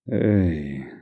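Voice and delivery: usual speaking voice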